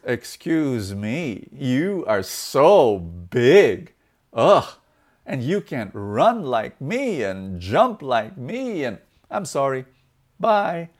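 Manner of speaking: very slow, drawn-out disdain; haughty brush-off. Acted. Deer rejects the elephant, slow hammed-up disdain. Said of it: "raises his eyebrow and says".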